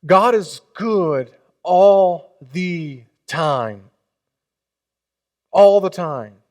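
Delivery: slow, loud congregational chant; call and response. Acted. Preacher chanting the response with the congregation, loud and slow. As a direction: draw each word out like the pastor. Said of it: "saying it with the pastor".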